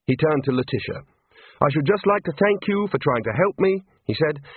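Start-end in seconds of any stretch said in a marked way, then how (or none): 1.6-3.8 higher-pitched, earnest politeness; courteous farewell, pitched up; polite thanks. A narrator's reading. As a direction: thank them formally in a raised voice. Rincewind formally thanks the ladies.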